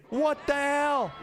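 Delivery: high, slow disbelieving cry; shocked. Real, not acted. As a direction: gasp it out in disbelief. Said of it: "You got my voice going up an octave".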